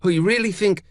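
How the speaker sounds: high, irritated insistence; snapping. A narrator's reading. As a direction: snap it, refusing help. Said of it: "she snapped".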